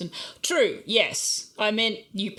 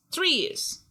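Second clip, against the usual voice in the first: much higher, stressed correction; high emphatic; 10 semitones higher, 3 dB louder than usual. Shown as a demonstration. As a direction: squeak it out emphatically.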